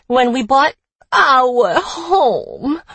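put-on pouting Boston accent on our home; whiny pout. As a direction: whine it like a sulking child. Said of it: "She pouts in a whiny voice".

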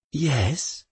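usual speaking voice

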